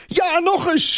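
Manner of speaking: put-on high, cheering girl. A narrator's reading. Thérèse dancing and cheering him on, high cheer. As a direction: squeal with delight. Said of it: "juicht ze".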